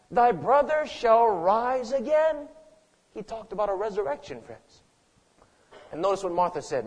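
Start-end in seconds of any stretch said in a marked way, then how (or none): 0.1-2.5 slow, higher declaration of a promise; emphatic proclamation. Acted. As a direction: proclaim it slowly, pitched up.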